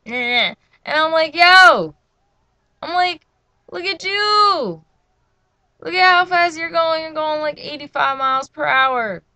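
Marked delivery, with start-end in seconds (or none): 1.4-1.9 high, very loud retold shout; loud incredulous holler; calling out. 3.7-9.2 high, loud retold protest; incredulous scolding. Retold scolding of speeding mom.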